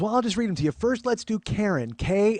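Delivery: high, insistent imitated aunt; helpful aunt. Aunt reading names out to nephew, fussy aunt voice. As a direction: spell it out as the meddling aunt.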